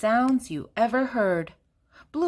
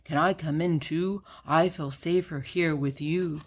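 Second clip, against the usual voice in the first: lower, gentle cow voice; a lowered cow asking to come in; cow's voice; 3 semitones lower than usual. A narrator's reading. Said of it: "said Cow".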